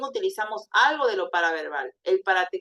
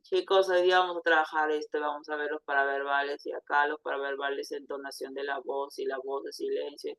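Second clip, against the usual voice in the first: low, soft monotone with no intonation; linear monotone; 5 semitones lower, 4 dB quieter than usual. Shown as a demonstration. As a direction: drone through it on one low note. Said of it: "Porque es una voz lineal".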